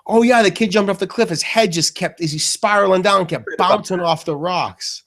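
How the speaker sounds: high, loud, eager gossip; eager Mikey. Retold or imitated. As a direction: pitch it up like he's thrilled to share. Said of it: "and Mikey's like".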